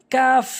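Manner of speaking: "কাফ" long, ending in hissed f; correct pronunciation. Shown as a demonstration. Teacher models the Arabic letter, long "কাফ". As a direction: stretch "কাফ" and finish on f. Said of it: "সঠিক উচ্চারণ".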